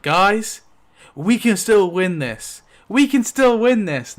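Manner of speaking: rally the group, rising each time; rallying cry. Retold or imitated. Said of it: "But Saul's like".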